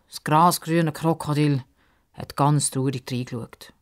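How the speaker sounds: plain reading voice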